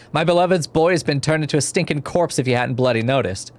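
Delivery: ordinary delivery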